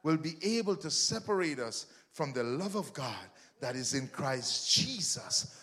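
ordinary delivery

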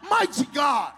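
loud rhythmic preaching run; preaching at full force. Real, not acted. Preacher builds the list of God's greatness loudly. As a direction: hammer each who's bigger line.

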